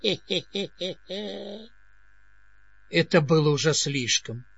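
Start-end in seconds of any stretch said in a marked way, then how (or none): 0.0-1.7 twitchy squeaking rat voice. A narrator's reading. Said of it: "издала странный звук".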